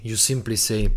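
usual speaking voice

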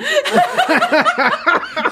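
shrieking burst of laughter; loud high laugh. Real, not acted. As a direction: let out a loud cackle. Big laugh after joke.

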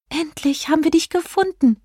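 high-pitched delighted fairy cry; high, happy exclamation; overjoyed. A narrator's reading. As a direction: call out with joy and relief, voice way up. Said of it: "rief Jelina überglücklich".

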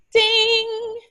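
high mock bell chime "Ding!"; a light, high playful ding; imitated bell ding. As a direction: ring it out like a bell going off.

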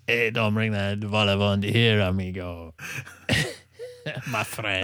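a hammy fake Mexican accent teasing a friend; Hollywood Mexican accent. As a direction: play a cartoon bandit in a put-on Mexican accent. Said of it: "I went and did the Hollywood Mexican accent".

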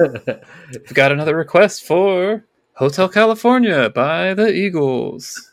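slow, loud, high song intro; hammed-up radio DJ. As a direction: announce the song, stretching the ends. DJ announcing a request.

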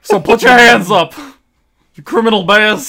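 high, loud cartoon command; bear making an arrest. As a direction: order the criminals to surrender. Yogi holds bears at gunpoint.

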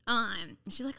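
ordinary delivery

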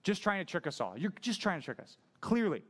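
mock-angry Pharisee pointing the finger; angry accusation. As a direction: accuse him, leaning hard on clearly. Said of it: "Pharisees are just angry at this dude".

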